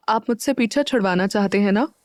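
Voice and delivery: usual speaking voice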